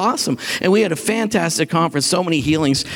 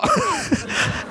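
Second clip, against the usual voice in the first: airy laugh, pitched way up; high breathy laugh; 4 dB quieter than usual. Real, not acted. Telling the congregation about the healing, a high airy laugh. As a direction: laugh out in delighted disbelief.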